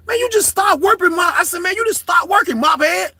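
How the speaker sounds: high, loud taunting yell; retold loud insult. Inmate taunting the officer with a nickname. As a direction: hurl the nickname at her.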